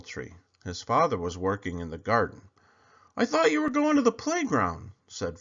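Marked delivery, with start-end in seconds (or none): 3.2-4.9 high, curious dad voice; father's voice. A narrator's reading. Father asking his son in the garden. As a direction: ask your son gently why he's home.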